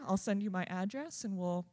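usual speaking voice

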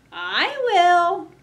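high meowy kitty voice; meowing cat voice. Acted. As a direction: mew it like a cat. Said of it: "meowed the cat".

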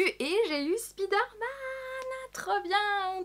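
high, drawn-out glee; gleeful celebration. Real, not acted. Unboxer celebrating getting Spider-Man, high gleeful cry. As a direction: stretch it out high with delight.

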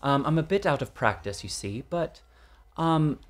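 measured Received Pronunciation; slow, formal RP English; RP English accent. Shown as a demonstration. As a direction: demonstrate proper southern British RP. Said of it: "can you do a little bit of, of that, that R P?".

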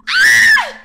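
very high, loud shriek of disbelief; shocked scream. Retold or imitated.

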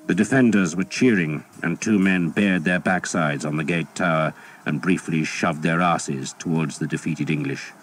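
ordinary delivery, nothing marked

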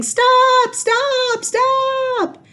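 very high, loud shout fading down; all the parents calling out; high urgent group yell. A narrator's reading. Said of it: "all the parents ran along the river yelling".

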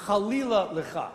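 chalila lecha with a guttural ch; Hebrew phrase. Shown as a demonstration. Preacher quoting the Hebrew to the congregation, throaty ch. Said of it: "The Hebrew is".

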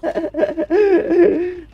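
loud, high-pitched laughing burst; real loud giggling cackle. Real, not acted. Laughing while playing the game.

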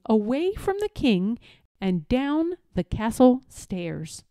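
usual speaking voice

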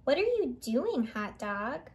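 ordinary delivery

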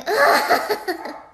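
loud, high laugh; high-pitched giggle. Real, not acted. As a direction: burst out laughing at your own blunder. Laughs at own mistake.

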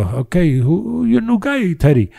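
high-pitched mock Spanish accent asking a question; a hammed-up Spanish accent, rising high on "who your". Trainers teasing Teddy in a mock Spanish accent. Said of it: "putting on that accent".

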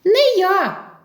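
high mouse voice; refusing little mouse. Acted. First mouse refuses the rooster, high little voice. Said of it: "отказує одне мишеня".

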